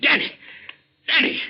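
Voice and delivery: high, loud cry of alarm; alarmed shout. Acted. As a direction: call out as your friend goes down. Friend falls, calling out.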